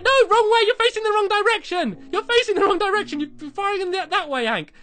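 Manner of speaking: high, loud calls at the dog; excited yelling. Real, not acted. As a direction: shout directions at a hopeless pet. Yelling at dog firing wrong.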